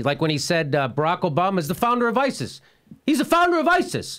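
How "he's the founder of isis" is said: high-pitched, emphatic imitation; mock insistence. Host retelling a candidate's wild claim, mock insistence. Retold claim, escalating repeats.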